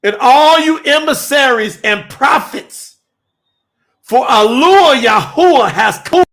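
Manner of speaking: loud, high, fiery sermon climax; full-force preaching. Acted. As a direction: proclaim it like a revival preacher.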